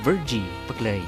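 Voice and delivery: normal talking voice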